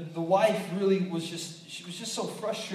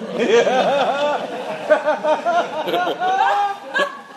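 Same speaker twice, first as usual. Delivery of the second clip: big high burst of laughter; cracking up at his own joke; real laughter over the puppy joke; loud real laugh; 8 semitones higher, 9 dB louder than usual. Real, not acted.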